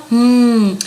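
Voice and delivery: usual speaking voice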